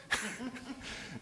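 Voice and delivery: soft airy chuckle; breathy laugh. Real, not acted. Preacher laughing softly at his own joke. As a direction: let a quiet laugh slip out.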